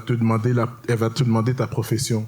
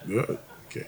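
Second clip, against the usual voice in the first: whispered "Good, ok"; hushed doctor; 3 semitones lower, 11 dB quieter than usual. Retold or imitated. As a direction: drop to a breath, clinical.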